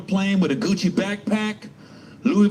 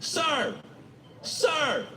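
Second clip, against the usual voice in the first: higher, repeated pleading sir; pestering passenger; 8 semitones higher, 6 dB quieter, much slower than usual. Retold or imitated. Passenger nagging him to switch seats, higher then shouting. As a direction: keep nagging, rising to a shout. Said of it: "They'll just come over".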